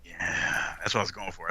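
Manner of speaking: low, breathy lounge-lizard whisper; Val Venis voice. Pretend. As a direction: purr it low and smug.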